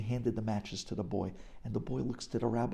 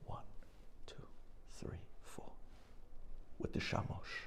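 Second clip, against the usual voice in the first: softly whispered counting; awed whisper; 14 dB quieter than usual. A narrator's reading. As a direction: count the candles in hushed wonder. Counting the lights in awe.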